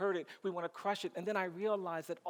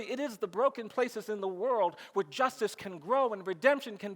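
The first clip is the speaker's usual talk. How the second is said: rising, louder oratory, building conviction; rousing speech; 3 semitones higher, 4 dB louder than usual. Acted. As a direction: preach it to the room, building up.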